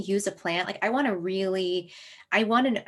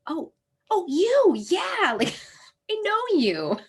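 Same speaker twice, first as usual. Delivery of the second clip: much higher, breathless, laughing through it; giddy recognition; 12 semitones higher, 3 dB louder, slower than usual. Retold or imitated. Speaker meeting the blue vervain plant, giddy high squeal with laughs. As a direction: squeal like meeting a dear old friend.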